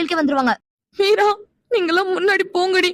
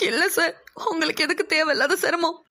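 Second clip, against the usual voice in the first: crying; 6 dB quieter than usual. Acted. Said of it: "நீ அழாத".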